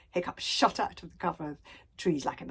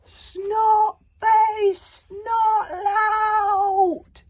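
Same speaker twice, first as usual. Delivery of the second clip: high, loud falling scream; 10 dB louder, much slower than usual. A narrator's reading. Hiccup falling off the cliff, screaming. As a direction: scream it while plunging. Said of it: "screamed Hiccup as he fell".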